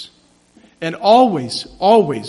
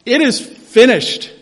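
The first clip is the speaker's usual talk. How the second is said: raised, higher proclamation; crying out; 5 semitones higher, 5 dB louder, slower than usual. Retold or imitated. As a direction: call it out for the whole universe to hear. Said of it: "And so he cried out to the Heavenly Father".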